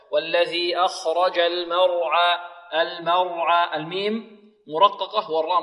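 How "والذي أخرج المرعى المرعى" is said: slow, measured tajweed recitation with long held vowels; Quran recitation. Shown as a demonstration. Teacher models the verse for pupils, slow tajweed recitation. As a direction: draw out each syllable in an even chant.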